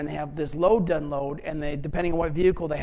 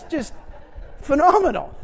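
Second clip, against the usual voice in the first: amazed exclaim; 8 semitones higher than usual. Real, not acted. Preacher marvelling at his son.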